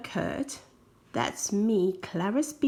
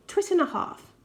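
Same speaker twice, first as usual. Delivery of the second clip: cheeky comeback; 8 semitones higher than usual. Retold or imitated. Sibling insult exchange.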